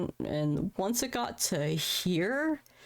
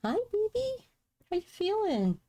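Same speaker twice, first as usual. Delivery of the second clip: put-on much higher, sugary baby talk; baby-talk nurse. Nurses greeting her as baby, very high sugary coo. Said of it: "And they're like".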